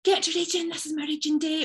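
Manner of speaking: high, eager sing-out; excited goal-setting. Retold or imitated. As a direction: chirp it like an over-keen goal-setter. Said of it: "So we get excited, we set big goals".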